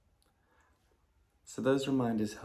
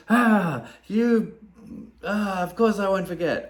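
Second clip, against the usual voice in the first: loud annoyed outburst, much higher; irritated snap; 9 semitones higher, 7 dB louder than usual. Retold or imitated. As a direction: flare up at the reminder. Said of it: "And you go".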